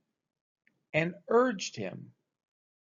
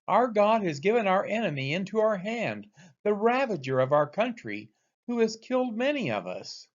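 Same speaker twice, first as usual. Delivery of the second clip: triumphant crowd; 6 semitones higher than usual. Acted. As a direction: exult over the captured enemy. Philistine crowd praising their god.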